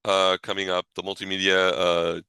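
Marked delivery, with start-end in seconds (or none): none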